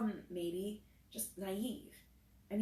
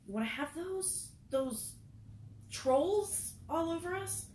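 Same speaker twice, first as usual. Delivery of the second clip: scolding; 6 semitones higher than usual. A narrator's reading. April scolding boys in tunnel.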